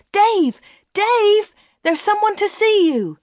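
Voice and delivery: high, loud call up the stairs. A narrator's reading. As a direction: call upstairs to fetch someone. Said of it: "and called".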